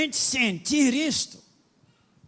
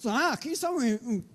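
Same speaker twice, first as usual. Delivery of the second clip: ordinary talk, nothing marked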